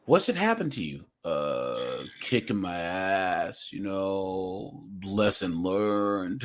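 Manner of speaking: low, soft, slow answer in character; quoted guy. Retold or imitated. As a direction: imitate a resigned man, drawing it out. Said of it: "the guy where he said".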